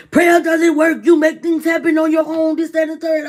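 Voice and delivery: high, loud imitation of a lecturing rider; pushy rider. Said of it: "he was like".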